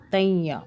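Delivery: तैया with a faint nasal hum before ya; light noon sound. Shown as a demonstration. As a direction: let a slight n colour तैया. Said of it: "हल्की सी नून की sound है".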